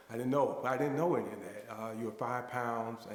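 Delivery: ordinary talk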